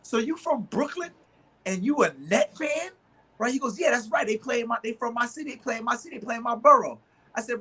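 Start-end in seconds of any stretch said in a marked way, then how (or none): 0.0-2.9 incredulous challenge. Retold or imitated. Retold mocking challenge. 3.8-7.0 defensive fan. Retold or imitated. Retold fan defending team.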